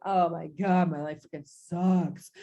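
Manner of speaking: low, glum inner voice; a hint of gloomy self-pity, pitched low; inner misery. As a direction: drop way down, mock despair. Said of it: "Inside, you're like".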